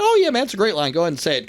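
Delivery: high, eager green light; enthusiastic approval. Retold or imitated. Writer approving the wrestler's promo line, high and enthusiastic. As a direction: imitate an easygoing writer jumping at the idea.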